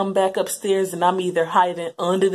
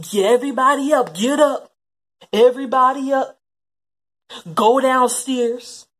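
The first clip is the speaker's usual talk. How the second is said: higher, louder commands to wake the house; parent yelling; 6 semitones higher, 9 dB louder than usual. Retold or imitated. Parent yelling at sleeping kids to get up and go downstairs. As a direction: bark the kids out of bed.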